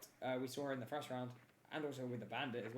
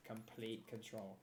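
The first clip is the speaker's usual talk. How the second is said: softer, drawn-out echo; slow quiet emphasis; 3 semitones lower, 8 dB quieter, much slower than usual. Real, not acted. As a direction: let it land slowly. Caster repeats for emphasis.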